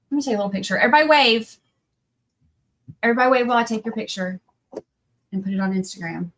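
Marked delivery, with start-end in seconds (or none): 0.8-1.6 loud, bright call to the room; rally the whole room for a photo. Real, not acted. Calling out to the team. 3.0-6.3 quick repeat. Real, not acted.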